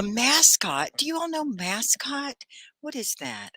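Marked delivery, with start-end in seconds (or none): 2.8-3.5 whispered question; soft whisper to the class. Real, not acted. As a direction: hush it like a secret. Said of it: "whispered question".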